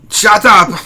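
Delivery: put-on loud, high Austrian-accented yell; mock angry shout. Streamer silences interrupting co-host, hammy Austrian shout. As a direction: explode at the interruption, hammed up.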